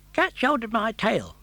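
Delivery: high, quick friendly fish voice; a chatty fish, fast and bright; tunny fish. Acted. The tunny fish greeting Pinocchio, quick and cheerful.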